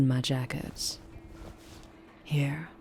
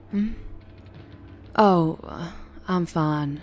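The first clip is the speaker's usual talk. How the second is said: ordinary talk, nothing marked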